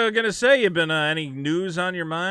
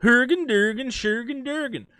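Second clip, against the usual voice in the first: lightly hammed-up Swedish-sounding gibberish, higher; mock Scandinavian babble, a bit higher; 7 semitones higher than usual.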